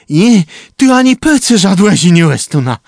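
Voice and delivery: loud, high shout. A narrator's reading. Trumba shouting at the others in defiance, a loud high shout. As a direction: yell it out with full force. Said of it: "krzyknął trumba".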